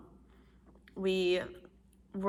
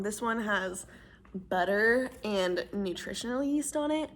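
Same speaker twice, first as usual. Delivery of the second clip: usual speaking voice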